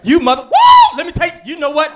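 loud, high angry mom threat; mom threatening. Retold or imitated. Mom scolding her daughter in Walmart, loud and high. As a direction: menace like a furious church mom. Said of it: "She was like".

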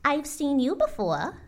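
high-voiced startled recognition; a touch of shock, high-pitched; Maisie gasping. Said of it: "gasped Maisie".

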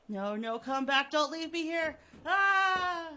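loud and high, building to a scream; desperate scream. Acted. Abandoned Harry screaming for Richard to come back. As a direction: scream after him in terror.

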